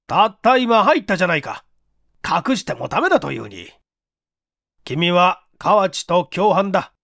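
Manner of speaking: stern chief. Acted. Chief accuses.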